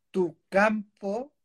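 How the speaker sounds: normal talking voice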